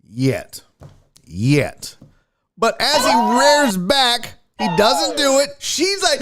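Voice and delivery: ordinary talk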